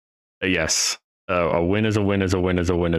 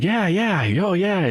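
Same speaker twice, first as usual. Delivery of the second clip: high, bubbly yeah yeah; super energetic; 11 semitones higher, slower than usual. Retold or imitated. Imitating the boss's son, high and bubbly. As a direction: bounce it like the ever-smiling son. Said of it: "just super energetic".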